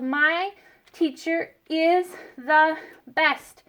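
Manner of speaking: slow, drawn-out model sentence; slow dictation. Shown as a demonstration. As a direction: spell it out slowly for kindergartners. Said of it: "So we spelled".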